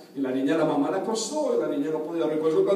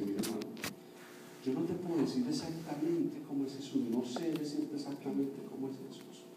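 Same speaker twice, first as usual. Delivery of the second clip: very soft, lowered storytelling voice; hushed confiding child; 3 semitones lower, 14 dB quieter than usual. Retold or imitated. Three-year-old answering her mother about Jesus, hushed. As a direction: quote a little girl confiding, fading almost to nothing.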